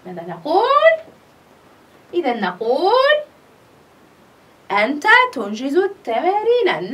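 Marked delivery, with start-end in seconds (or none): none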